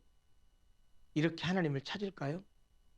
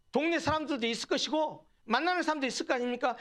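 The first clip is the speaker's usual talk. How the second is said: ordinary delivery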